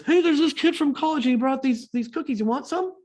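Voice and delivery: high, loud shout down to the studio; calling out. Retold or imitated. Producer calling down to the studio crew, acted as a high holler. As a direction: holler an offer to people downstairs.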